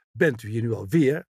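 mildly reproving question; vermanend. A narrator's reading. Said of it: "sprak ze vermanend". (licht vermanend en afkeurend)

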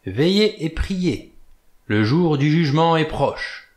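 put-on prophesy it like a drunken preacher; doom-laden prophet. A narrator's reading. Old sailor warns Blore of judgment, slow and high.

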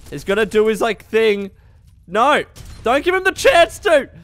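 ordinary talk, nothing marked

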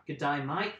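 hammed-up Aussie greeting; mock Australian accent. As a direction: put on a silly Australian twang. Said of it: "stupid good day, mate".

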